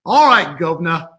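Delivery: bad Cockney accent, hammed up; a weak stab at Cockney. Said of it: "So bad".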